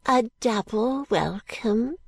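put-on high, slow, fussy old lady; an eccentric old woman, gracious; fluttery old lady. Miss Flite greets Caddy, high and slow.

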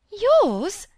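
very high, startled question; faint astonishment. A narrator's reading. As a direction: squeak it out in faint shock. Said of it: "said Miss Phoebe faintly".

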